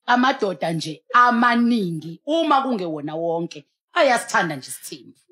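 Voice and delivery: ordinary talk, nothing marked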